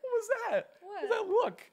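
high, loud laughing question. Real, not acted.

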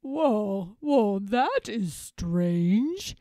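slow, drawn-out puzzlement; puzzled storybook elephant, lingering on the words; pondering Babar. A narrator's reading. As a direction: muse slowly to yourself. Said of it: "thinks Babar".

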